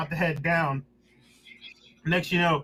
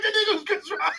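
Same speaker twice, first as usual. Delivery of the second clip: stunned laughing; 14 semitones higher, faster than usual. Retold or imitated. Stunned after door closes.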